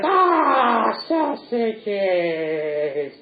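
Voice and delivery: high "ah" sliding down into long drawn-out delight; adoring Punch. Acted. Punch greets his beloved sausages, drawn-out mock rapture. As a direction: swoon hammily over a treat. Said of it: "which Punch adores".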